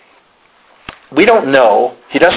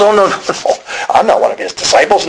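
high, protesting denial; hasty denial; 6 semitones higher than usual. Acted. Peter denying Jesus to a bystander, protesting. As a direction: wave it off in a raised pitch.

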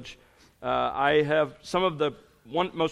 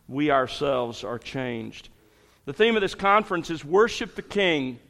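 ordinary talk

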